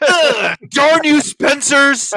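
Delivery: lightly voiced villain curse; bad Goldar. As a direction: shout it like a cartoon villain. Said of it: "not a good Goldar".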